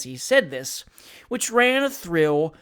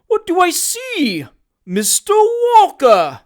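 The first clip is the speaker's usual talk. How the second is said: much higher, slow, put-on delighted cry; hammed-up joyful exclamation; 13 semitones higher, 9 dB louder, slower than usual. Job Trotter pretends joy at meeting Sam, hammed-up high cry. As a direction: fake a start of joy.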